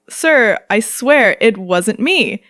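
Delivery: slow, deliberately calm denial; mock-cool slow plea; playing it cool. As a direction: drag it out like you're innocent. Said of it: "I try to play it cool".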